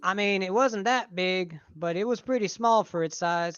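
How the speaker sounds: put-on light Southern accent, joking; mock Southern. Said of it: "he would talk like that".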